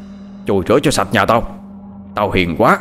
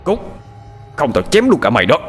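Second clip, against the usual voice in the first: snapped threat; 5 semitones higher than usual. Acted. Lão Tuất snapping at the father to get out. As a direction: cut him off with a threat. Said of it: "lão ta đã quát".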